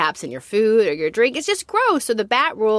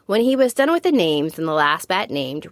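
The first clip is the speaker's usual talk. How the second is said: ordinary delivery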